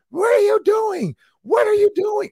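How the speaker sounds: very high, loud furious shout; coach shouting. Retold or imitated. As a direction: scream it at him twice, way up high. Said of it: "so he was like".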